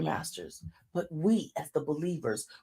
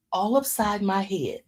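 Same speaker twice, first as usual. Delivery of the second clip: ordinary talk, nothing marked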